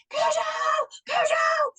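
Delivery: very high drawn-out call; retold screaming. Owner searching in loud rain, high screamed call. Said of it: "And I'm screaming".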